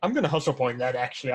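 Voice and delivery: ordinary talk, nothing marked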